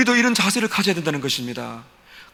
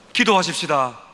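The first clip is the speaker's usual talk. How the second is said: normal talking voice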